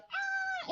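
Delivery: soft, very high imitated squeal; small-scale girls' scream; mock scream. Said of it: "the girls are all screaming like".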